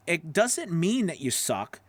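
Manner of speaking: ordinary delivery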